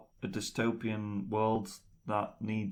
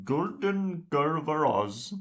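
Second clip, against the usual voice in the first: put-on Turkish accent; 7 semitones higher, 6 dB louder, slower than usual. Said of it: "He's Turkish".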